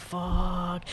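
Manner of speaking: low, soft, drawn-out curse; dismayed muttered curse. Retold or imitated. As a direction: let it sink out under your breath in dismay. Retelling his dismayed reaction.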